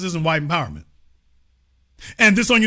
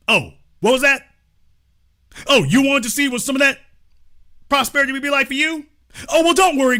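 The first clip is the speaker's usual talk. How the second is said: high, fast, sneering rich man; mock condescension; 6 semitones higher, faster than usual. Mock rich man mocking poor.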